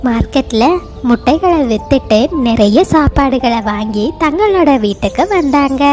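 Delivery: usual speaking voice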